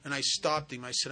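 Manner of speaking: ordinary talk, nothing marked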